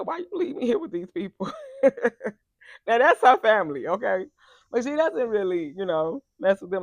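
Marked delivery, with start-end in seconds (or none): 0.0-1.5 much higher, laughing whine; sister's plea. Retold or imitated. Retelling the sister-in-law's call, high laughing whine. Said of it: "I'ma tell y'all what she said". 1.5-2.3 laughs. Real, not acted. Laughing at her own imitation.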